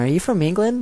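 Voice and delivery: high, rising puzzled question; curious classmate. Retold or imitated. Classmates asking a new student about the accent, high and curious. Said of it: "they'll ask me".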